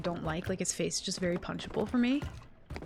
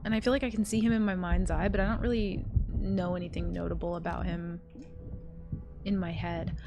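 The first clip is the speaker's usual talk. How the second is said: ordinary delivery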